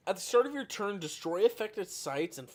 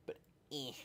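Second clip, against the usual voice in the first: whispering; 10 dB quieter than usual. Real, not acted. Dismissive aside.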